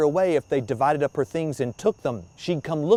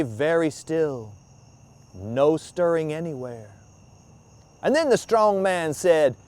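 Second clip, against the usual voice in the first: ordinary delivery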